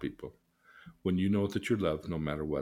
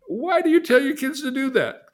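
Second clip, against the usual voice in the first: high, loud exasperated demand; 9 dB louder than usual. Retold or imitated. Therapist scolding a controlling parent, high and loud. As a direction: demand it like you want to slap him. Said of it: "I wanna slap him and say".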